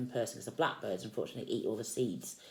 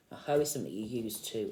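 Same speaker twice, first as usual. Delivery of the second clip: ordinary delivery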